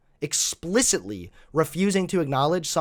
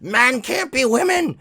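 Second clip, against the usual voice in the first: loud, high hammed-up scream; over-the-top imitation of shrieking outrage; mock screaming; 8 semitones higher, 5 dB louder than usual. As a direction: screech it in mockery of hysterical opponents. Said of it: "and start screaming".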